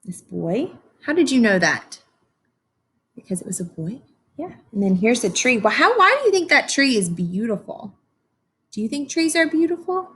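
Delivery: normal talking voice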